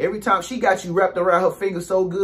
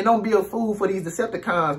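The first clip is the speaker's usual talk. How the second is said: normal talking voice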